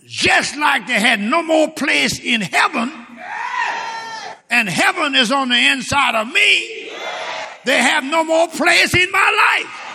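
fiery preaching. Acted. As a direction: preach it out to the whole church, full force. Preaching scripture at full force.

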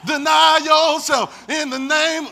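slow, loud, high cry with a laugh; drawn-out shout. Shown as a demonstration. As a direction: stretch it out and shout it. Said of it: "We're gonna do it".

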